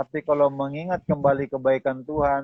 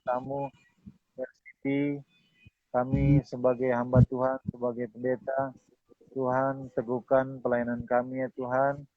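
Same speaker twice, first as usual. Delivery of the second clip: subdued, measured closing prayer; public prayer; 4 dB quieter than usual. Real, not acted. Pastor closing the session before God, prayerful. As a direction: lead them in reverent prayer, phrase by phrase.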